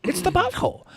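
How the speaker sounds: high, soft goofy squeak; high squeaky repeat. Pretend.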